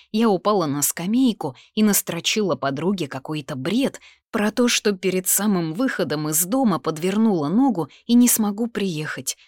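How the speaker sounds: ordinary delivery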